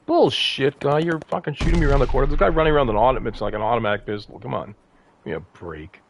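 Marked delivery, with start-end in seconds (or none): none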